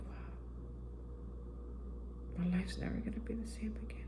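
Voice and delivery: soft, fast whispered wonder; whispered inner thought of awe; awed whisper. Retold or imitated. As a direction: breathe it out like a private realization. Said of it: "just thinking".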